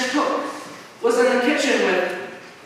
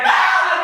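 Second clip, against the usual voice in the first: high-pitched yelp of a scream; short imitated shriek; acted scream; 7 semitones higher than usual. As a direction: scream out as the startled wife. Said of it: "Myra screams again".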